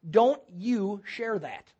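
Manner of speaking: slow, spaced-out retold warning; deliberate admonition. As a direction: give each word its own weight. Retold inner divine check.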